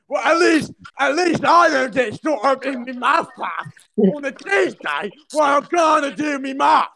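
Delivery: British impression. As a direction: screech a cartoon British lad's rant. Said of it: "impression of a British person".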